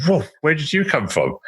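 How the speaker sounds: high-pitched mock astonishment; startled reaction. Whole carriage reacting to the voice, mock startled.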